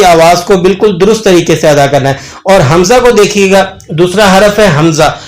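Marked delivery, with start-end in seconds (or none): none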